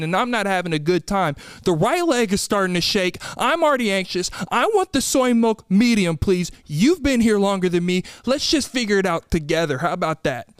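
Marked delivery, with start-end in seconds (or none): none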